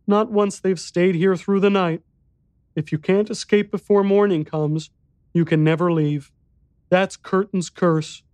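put-on high, grave child voice; solemn young boy. A narrator's reading. Boy explaining the curse, solemn child voice. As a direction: pronounce the curse as a serious little boy.

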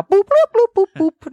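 high robot beeps in a sing-song; failed seductive. Shown as a demonstration. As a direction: bleep like a flirty little robot. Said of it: "from my voice what I would like to talk about now".

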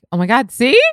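high, loud rising inflection; English inflection. Shown as a demonstration. Showing what she becomes in English, exaggerated upward inflection. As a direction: let it shoot up high at the end. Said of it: "I don't know what I become".

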